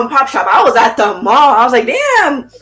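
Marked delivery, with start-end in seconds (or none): 1.8-2.5 very high, laughing amazement; amazed glee. Retold or imitated. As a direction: squeal like you just got rich. Amazed at cheap prices.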